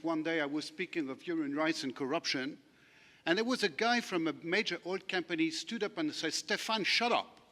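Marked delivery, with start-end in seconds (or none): 6.3-7.4 higher-pitched mock telling-off; imitated curt rebuke. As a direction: imitate a heckler cutting the speaker off. Heckler interrupts speaker at conference.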